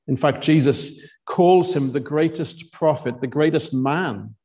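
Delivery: usual speaking voice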